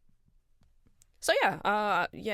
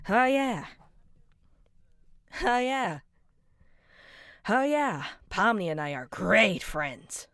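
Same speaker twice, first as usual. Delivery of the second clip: loud, higher mock-bright, slow on the friends line; sarcastic oh yeah; 4 semitones higher, 5 dB louder, slower than usual. Bitter character sarcastically calling Pomni a great friend. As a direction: sell the lie with bitter cheer.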